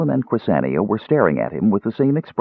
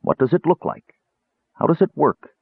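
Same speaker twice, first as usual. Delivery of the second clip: higher, urgent, feverish questions; feverish questioning; 8 semitones higher than usual. A narrator's reading. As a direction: press him eagerly for answers. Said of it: "the strange tone of his voice".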